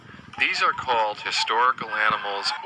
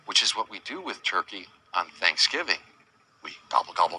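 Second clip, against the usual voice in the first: ordinary delivery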